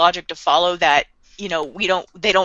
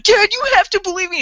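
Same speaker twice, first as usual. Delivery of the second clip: much higher, a mock panicked plea; desperate plea; 11 semitones higher, 3 dB louder, faster than usual. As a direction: beg like your life depends on it. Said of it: "His voice is cracking".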